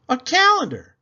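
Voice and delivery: high, loud burst of discovery; sudden idea. Acted. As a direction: blurt it out like a bright idea. Amelia has an idea.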